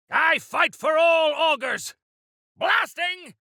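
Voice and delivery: loud, high fighting cry; combat shout. Acted. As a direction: yell it mid-battle. Combat cries.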